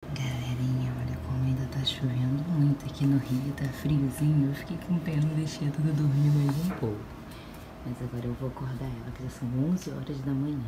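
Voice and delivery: hushed morning whisper. Real, not acted. Mom whispering while daughter sleeps.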